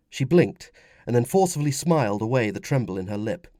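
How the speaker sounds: plain reading voice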